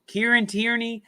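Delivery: ordinary talk, nothing marked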